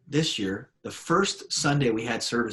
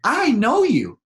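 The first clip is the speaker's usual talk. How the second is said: put-on much higher, louder little boy; small child voice; 14 semitones higher, 9 dB louder, slower than usual. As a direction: play a bold little kid. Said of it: "He's like".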